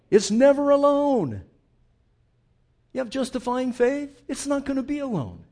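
loud, high preacher's cry; preaching at full force. Real, not acted. Preacher shouting to Calvinists in the pews. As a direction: hammer it home to the congregation.

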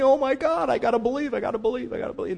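high, mock-frantic repeating, fading out; hammed-up panic under pressure; pressured panic. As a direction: act out anxious straining to believe. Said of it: "puts all the pressure on me".

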